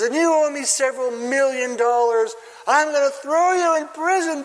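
high-pitched hammed-up threat, building; melodramatic king. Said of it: "like a true melodrama".

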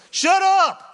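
high, loud mock shout; yelling shut up at the movie spoiler; annoyed shout. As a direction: snap at the know-it-all friend. Irritated viewer snaps at rewatcher.

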